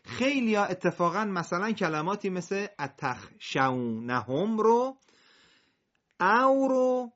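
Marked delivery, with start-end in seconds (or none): 4.0-7.1 هم and او said with a high mocking o instead of a; wrong o sound. Shown as a demonstration. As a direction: imitate the wrong vowel in او. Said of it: "صدای او در میاد". (تلفظ غلط با صدای او)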